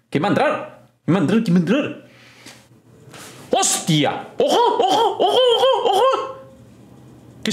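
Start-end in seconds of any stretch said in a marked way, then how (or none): 4.4-6.4 excited laughing. Real, not acted. Startled by entrance.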